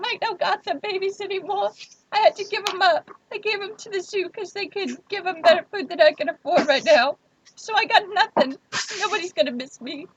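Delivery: high, hammed-up teary voice breaking; mock crying. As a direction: fake sobbing to guilt him. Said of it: "all the emotional trauma in my voice".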